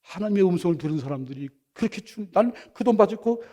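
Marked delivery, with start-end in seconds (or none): none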